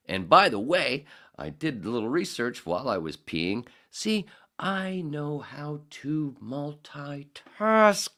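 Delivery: high, loud, slow sing-song brag; playful boast. Acted. Host coming back from the bathroom, high goofy brag.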